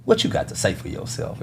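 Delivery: soft breathy mock scold, low; half-whispered fed-up question; disgusted. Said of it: "she kinda disgusted".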